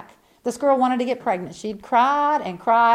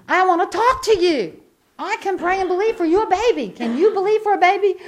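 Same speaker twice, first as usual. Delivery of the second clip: high, raised retold appeal; insistent; 7 semitones higher, 5 dB louder than usual. Preacher offering prayer to crying girl, loud insistence. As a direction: press her loudly over the crying.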